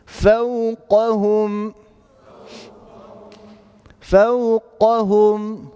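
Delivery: drawn out, very slow; فوقهم recited very slowly; slow recitation. Shown as a demonstration. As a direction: stretch each sound of فوقهم. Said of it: "teacher repeats word slowly".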